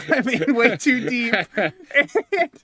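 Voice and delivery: laughing through the words, pitch rising. Real, not acted. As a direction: giggle it out, caught in his own lie. Laughing at his own lie.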